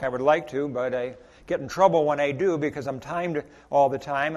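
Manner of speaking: ordinary delivery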